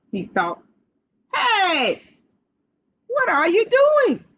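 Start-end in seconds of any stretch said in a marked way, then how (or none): 1.3-4.2 hollering fox. Acted. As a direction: holler it from behind the tree. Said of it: "he hollered".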